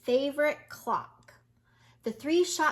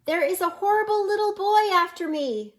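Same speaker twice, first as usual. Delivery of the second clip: higher, louder fake panic; yelled; 8 semitones higher, 7 dB louder, faster than usual. A narrator's reading. Wolf fooling the shopkeepers, loud mock panic. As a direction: cry wolf, playing at being chased. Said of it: "yelled at the top of his lungs".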